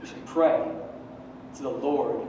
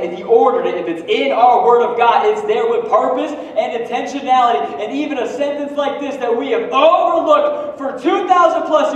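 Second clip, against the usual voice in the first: loud, high preaching build; fired-up sermon peak; preaching at full force; 7 semitones higher, 8 dB louder than usual. Real, not acted. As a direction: preach it hard to the congregation. Preaching climax.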